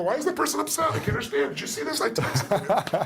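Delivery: high, squeaky clueless imitation; hammed-up puzzled whine in a high voice; mock bewildered texter. Mimics clueless person who texted a breakup.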